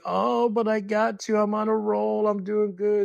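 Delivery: high-pitched, chirpy mock friend voice; imitating Marcia. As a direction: pitch way up, playing her carefree excuse. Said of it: "She's like".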